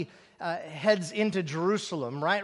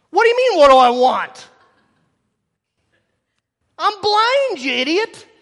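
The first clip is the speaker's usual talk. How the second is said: loud, higher exasperated retort; sarcastic outburst; 8 semitones higher, 11 dB louder than usual. Retold or imitated. Preacher's imagined retort to Jesus, loud mock exasperation. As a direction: snap back with mock outrage. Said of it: "I can be a little snarky".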